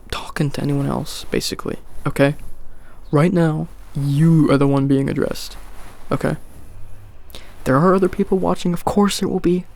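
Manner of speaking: normal talking voice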